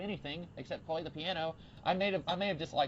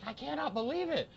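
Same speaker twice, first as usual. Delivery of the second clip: high-pitched amazed exclamation; astounded; 13 semitones higher than usual. Retold or imitated. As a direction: re-enact utter disbelief, voice shooting up.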